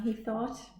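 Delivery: ordinary delivery, nothing marked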